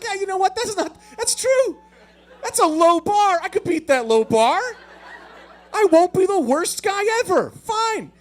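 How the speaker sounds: high, loud indignant rallying; hammed-up fired-up outburst; worked-up agreement. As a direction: talk yourself into it at full pitch. Acts himself getting fired up, indignant.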